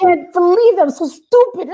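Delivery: high-pitched mock self-reproach; nervous self-scolding. Nervous DJ berating herself with the mic on, mock-panicked. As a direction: wail at yourself in replayed panic. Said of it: "I was so nervous".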